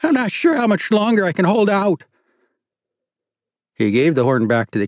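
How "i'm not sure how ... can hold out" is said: high, loud pleading yell; desperate shout. A narrator's reading. Woman shouting to the reverend outside, high desperate yell. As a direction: call out frantic from inside.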